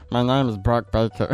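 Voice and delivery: a light mock American accent through laughter; lightly hammed American accent, laughing; imitating Tom Hardy's American accent. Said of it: "Tom Hardy's American accent".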